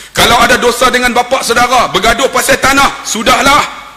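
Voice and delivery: high, loud preaching; forceful, high-pitched proclamation; khutbah delivery. Retold or imitated. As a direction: raise your voice like a Friday preacher. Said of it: "Khutbah Jumaat".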